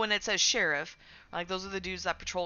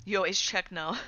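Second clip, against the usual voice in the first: ordinary talk, nothing marked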